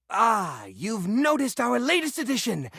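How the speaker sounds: normal talking voice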